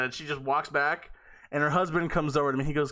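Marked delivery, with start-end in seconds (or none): none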